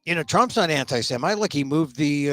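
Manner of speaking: ordinary talk, nothing marked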